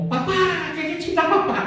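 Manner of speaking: high-pitched mock calling-out to papa; high, quick holler without real volume; imitated child's yell.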